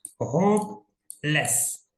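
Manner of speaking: होपलेस drawn out, with a long gap before लेस; teacher spacing out both halves of होपलेस; two separate syllables. Shown as a demonstration. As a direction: split होपलेस into होप and लेस. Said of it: "जिसमें दो syllable हो".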